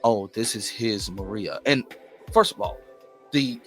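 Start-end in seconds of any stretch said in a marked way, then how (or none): none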